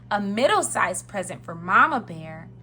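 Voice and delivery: usual speaking voice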